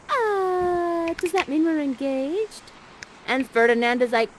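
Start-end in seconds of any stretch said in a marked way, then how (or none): 0.1-2.7 higher, slowish and eager; lightly voiced hopeful girl. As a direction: ask shyly, hoping for yes. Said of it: "And then Miranda's like".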